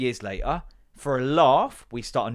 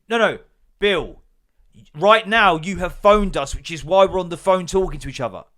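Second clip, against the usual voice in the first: loud, higher, slowing on right now; exasperated near-shout; 4 semitones higher, 8 dB louder than usual. Retold or imitated. To Bill on the phone, loud exasperated insistence. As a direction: hammer the point home to Bill.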